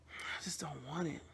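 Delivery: soft real whisper. Real, not acted. Tired of reminders, she whispers to herself. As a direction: breathe it out under your breath. Quiet aside after venting.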